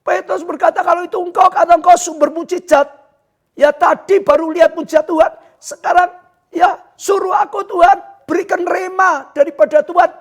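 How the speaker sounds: loud, high-pitched sermon delivery; full-force preaching. Real, not acted. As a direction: preach at the top of your voice. (khotbah berapi-api)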